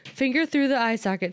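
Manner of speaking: usual speaking voice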